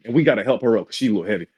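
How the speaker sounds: plain reading voice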